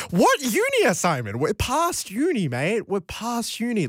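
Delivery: high, incredulous scoff at uni; mock indignation. Real, not acted. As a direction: tease the texter for still being at uni. Teasing a listener's text.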